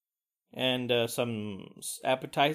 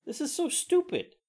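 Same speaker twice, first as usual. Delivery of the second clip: high-pitched disbelieving complaint; exasperated squeak of disbelief; incredulous; 9 semitones higher than usual. Retold or imitated. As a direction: pitch way up in mock outrage at splitting the bill. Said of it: "And I was like".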